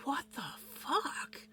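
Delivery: slow, hushed whisper of disbelief; stunned mock whisper. Voices their stunned look at her.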